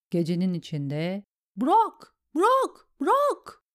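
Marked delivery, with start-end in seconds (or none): 1.6-3.6 very high, loud repeated command; shouting. A narrator's reading. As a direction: shout each release separately. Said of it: "diye bağırmıştı bir ses". (tiz, yüksek sesle bağırarak)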